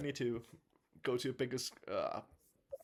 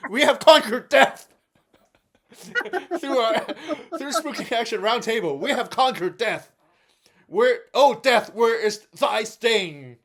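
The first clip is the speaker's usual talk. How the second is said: triumphant shout; 7 semitones higher, 10 dB louder than usual. Acted. Triumphant proclamation.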